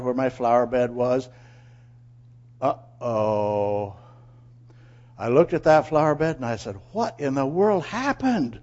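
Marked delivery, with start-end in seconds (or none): 2.6-3.9 slow, sinking uh-oh; an uh-oh on seeing trouble; dismayed uh-oh. Acted. Man finds his flower bed wrecked, dismayed. 6.9-8.6 slow, high disbelief; aghast, drawing out the question; astonished. Acted. Man sees holes in his flower bed, astonished.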